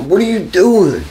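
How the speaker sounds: startled, high pitched what are you doing; shocked question. Retold or imitated. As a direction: catch him in the act, pitch jumping up. Catching friend peeing on grave.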